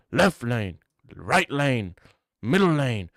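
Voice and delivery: mock British accent, pushy and loud; hammed-up British bloke begging for feedback; British Left Lane. Left Lane boasting to a girl, mock British accent. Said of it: "He's, like, British".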